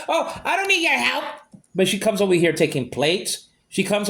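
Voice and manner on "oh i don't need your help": mocking her words. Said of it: "she took".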